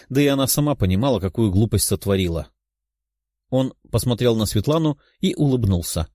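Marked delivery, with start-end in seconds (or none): none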